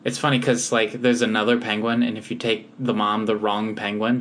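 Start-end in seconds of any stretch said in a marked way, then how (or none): none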